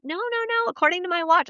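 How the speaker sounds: lightly voiced friend arguing back; insistent friend. Quoting friend in argument.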